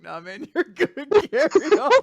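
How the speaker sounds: high voice breaking into laughter; laughing harder as it goes; laughing retelling. Retold or imitated. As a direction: act out the quote through laughter. Retelling salesman while cracking up.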